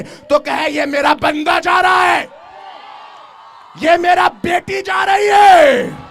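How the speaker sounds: high, loud preaching shout; fiery sermon shout; full-force preaching. Acted. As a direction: preach it out to the crowd. Preacher rallying the men.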